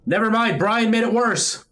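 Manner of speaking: high, loud laughing panic; frantic shouting. Real, not acted. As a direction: yell at him in playful panic. Game gets worse, blaming friend.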